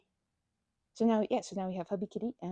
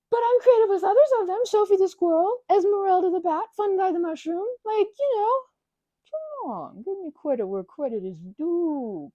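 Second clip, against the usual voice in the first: much higher, louder defence of her naming; playful mock indignation; 9 semitones higher, 7 dB louder than usual. Real, not acted. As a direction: demand credit, hammed-up. Defending her plushie names.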